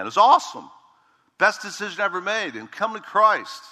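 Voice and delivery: it was awesome, called out bright and high; preacher's testimony burst; excited. Real, not acted. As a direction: lift it up with joy. Joyful testimony of salvation.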